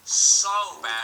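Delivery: high, loud and yearning; emphatic. Real, not acted. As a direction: really lean into wanting it. Stressing how much he wanted it.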